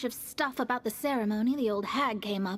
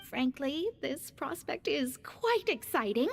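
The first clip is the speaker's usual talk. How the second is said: usual speaking voice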